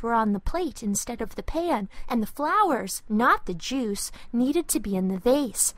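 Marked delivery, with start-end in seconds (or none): none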